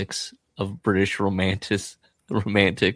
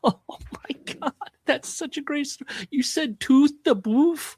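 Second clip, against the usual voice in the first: high, breathy, slow gushing; mock-adoring high voice; gushing fanboy; much slower than usual. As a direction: swoon over her story. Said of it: "he's just gushing about her".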